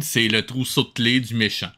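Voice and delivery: ordinary talk, nothing marked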